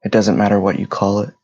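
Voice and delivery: measured meditation voice with long pauses between phrases; guided meditation. Real, not acted. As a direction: guide listeners through their breathing, phrase by phrase. Leads a breathing meditation.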